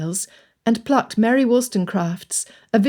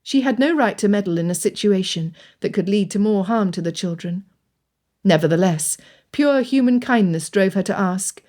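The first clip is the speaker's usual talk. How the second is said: usual speaking voice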